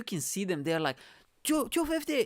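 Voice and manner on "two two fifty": very high imitated bid from the crowd; retold excitement, pitched way up; excited bidders. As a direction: call out an eager bid. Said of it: "they're getting super into it".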